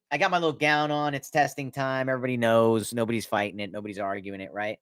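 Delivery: ordinary delivery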